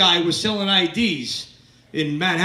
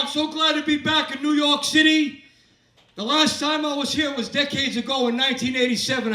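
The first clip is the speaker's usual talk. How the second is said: usual speaking voice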